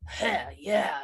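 lightly throaty, deep-ish imitation of the narrator's Reed; a hint of a macho guy voice; mocking a narrator's manly voice. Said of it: "Reed voice was like".

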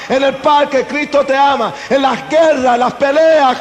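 lifted, ringing call to the crowd; preaching proclamation. Acted. Preacher opening his sermon to the congregation, proclaiming.

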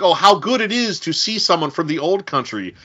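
effusive welcome in a put-on Ukrainian accent; imitated Ukrainian accent. Refugee greeting a supposed countryman backstage, imitated Ukrainian accent. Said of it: "a Ukrainian refugee".

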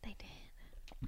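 hushed unvoiced whisper. Real, not acted. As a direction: breathe it without voice. Whispered aside.

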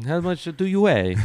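badly faked Italian accent; attempted Italian accent. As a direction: redo the bad Italian accent for laughs. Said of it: "I don't know how to do an Italian accent".

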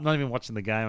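ordinary delivery

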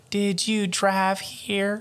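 put-on high, slow old-lady voice; slow, softly eerie old woman. A narrator's reading. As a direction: draw it out like a creepy granny. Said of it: "sound more and more like the old woman".

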